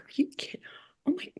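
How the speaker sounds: whispered disbelief; awed whisper. Retold or imitated. Reading the rabbi's thank-you text, whispered awe. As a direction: breathe it out in stunned wonder.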